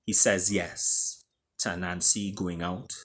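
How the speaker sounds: usual speaking voice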